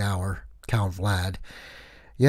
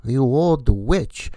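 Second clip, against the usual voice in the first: high furious yell at a slow flyer; angry shout; 9 semitones higher, 8 dB louder, slower than usual. Acted. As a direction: scream it in outrage. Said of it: "I was like".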